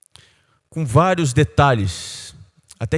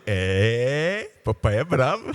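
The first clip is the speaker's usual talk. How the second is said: lightly voiced toddler, soft and quiet; small child voice; 4 semitones lower, 5 dB quieter, much slower than usual. A toddler telling his dad he's grumpy, softly voiced. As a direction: say it softly like a little boy.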